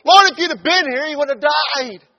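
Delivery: high, loud, indignant shout; with an attitude. Acted. Martha blaming Jesus for coming too late, shouting with attitude. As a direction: hurl the blame at him, loud and high. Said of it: "Martha came with".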